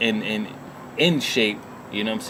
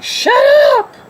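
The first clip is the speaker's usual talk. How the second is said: mother's shout; 12 dB louder than usual. Retold or imitated. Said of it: "my mother was just like".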